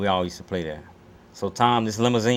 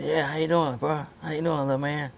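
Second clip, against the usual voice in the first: a pitched-up take on a rough, friendly greeting; easygoing big-star greeting, lightly imitated; 7 semitones higher than usual. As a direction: lightly voice the star saying hello to a kid. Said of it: "he had this rough voice".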